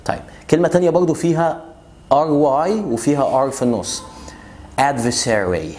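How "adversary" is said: adversary said with the first R dropped; adversary without middle R. Shown as a demonstration. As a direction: say adversary, keeping only the final R sound. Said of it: "ما تنطقش أول R".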